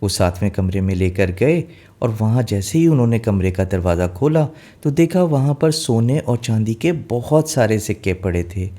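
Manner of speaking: ordinary delivery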